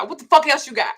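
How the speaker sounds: high, loud yell; shouted demand. Retold or imitated. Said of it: "My daddy told me".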